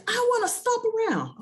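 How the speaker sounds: put-on very high child voice, pouty; a little girl wanting to stomp; cranky toddler. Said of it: "She's like".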